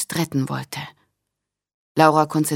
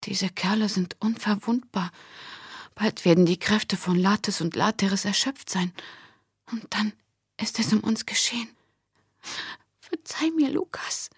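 soft, strangled whisper full of fear; Laura apologising to her brother in a choked whisper; 5 semitones higher, 6 dB quieter than usual. A narrator's reading. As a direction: whisper as if your throat is closing. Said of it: "flüsterte Laura mit erstickter Stimme".

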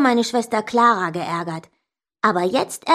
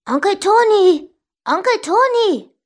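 high, loud impatient call; 10 semitones higher, 5 dB louder than usual. A narrator's reading. Child calls uncle at his door, high impatient shouts.

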